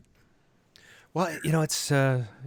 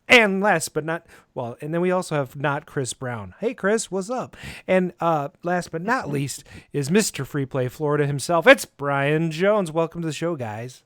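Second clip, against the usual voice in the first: upbeat announcer voice, louder; podcast host intro; 6 dB louder, slower than usual. Acted. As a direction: welcome listeners like a radio host.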